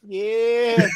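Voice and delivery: "Yes" stretched out high; drawn-out yes. Retold or imitated. Host echoes viewer's spelling, "Yes" long and high. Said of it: "the long S".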